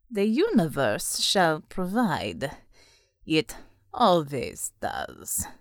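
low, slow, silky devil voice; a devil soothing an ally, unhurried; the devil, calm. Acted. The devil reassures dark man.

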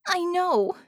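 high, despairing cry of frustration; frustrated despair. A narrator's reading. Harmony fakes despair to the genie, frustrated. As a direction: let it crack out of her, high. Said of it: "with frustration and despair in her voice".

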